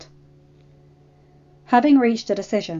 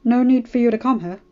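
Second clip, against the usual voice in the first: put-on nervous stammering boy; hurried, trying to deflect; flustered; 3 semitones higher, 8 dB louder than usual. A narrator's reading. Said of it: "John was even more startled".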